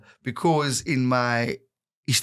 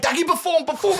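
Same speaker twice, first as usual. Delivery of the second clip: urging shout. Retold or imitated. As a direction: shout him onto the stage. Teammate urging him at braai.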